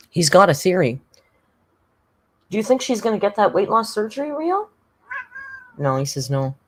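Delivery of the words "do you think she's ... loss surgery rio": high pet-talk voice. Acted. Asks her cat a question.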